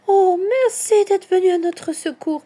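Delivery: high, trembling thanks. A narrator's reading. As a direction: thank the rescuers with a shaken, high voice. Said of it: "d'une voix tremblante". (voix tremblante et aiguë)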